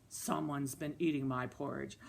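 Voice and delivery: low storybook bear; deep-pitched Papa Bear for small children. Acted. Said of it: "make a Papa Bear voice".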